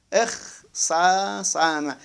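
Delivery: drawn-out اختصم with the doubled sad held; slow drawn-out ikhtassama. Shown as a demonstration. Teacher sounding out the word slowly for students. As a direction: spell out اختصم syllable by syllable, slowly. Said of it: "خب".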